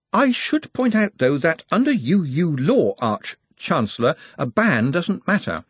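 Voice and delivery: hint of a nervous assistant; Ponder, cautious. A narrator's reading. Ponder voiced.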